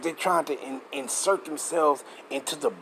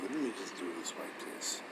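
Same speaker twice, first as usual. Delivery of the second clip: whisper; 7 semitones lower, 12 dB quieter than usual. Real, not acted. Reading to himself, muttering aside.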